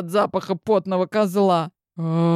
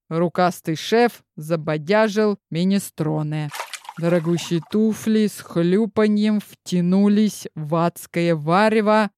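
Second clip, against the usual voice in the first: plain reading voice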